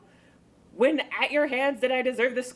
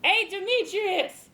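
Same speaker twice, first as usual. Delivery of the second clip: higher, slow call across the woods; calling after him; 8 semitones higher, slower than usual. Acted. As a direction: call out to the man running off. Helena calls after Demetrius.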